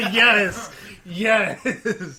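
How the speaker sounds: excited. Real, not acted. Cheering with laughter.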